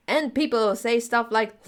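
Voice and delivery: ordinary delivery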